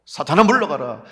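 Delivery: loud rebuke; commanding rebuke. Acted. Believer driving off Satan, loud commanding rebuke. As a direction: order the tempter away loudly.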